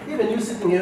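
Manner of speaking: usual speaking voice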